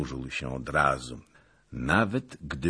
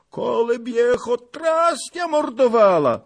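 high, loud outcry; old man's cry; 10 dB louder than usual. A narrator's reading. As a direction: call out in vehement protest. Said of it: "zawołał starzec". (głośny okrzyk starca)

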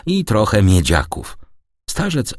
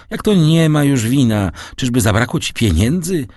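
higher, louder questioning in disbelief; alarmed cry; 6 semitones higher, 3 dB louder than usual. A narrator's reading. Son asking his father about money, alarmed and raised. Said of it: "zawołał Edmund, blednąc".

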